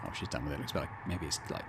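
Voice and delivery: normal talking voice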